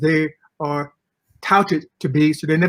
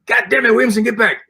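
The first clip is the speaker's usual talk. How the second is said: raised, louder barking of an angry coach; retold coach yell; 6 semitones higher, 5 dB louder, faster than usual. Coach yelling at his rookie, mock angry shout. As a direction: yell it like the coach across the field. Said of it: "the coach is yelling".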